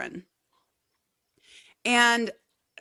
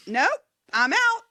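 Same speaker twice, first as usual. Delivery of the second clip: mock refusal; 10 semitones higher, 3 dB louder than usual. Retold refusal at bakery.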